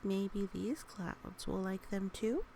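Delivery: plain reading voice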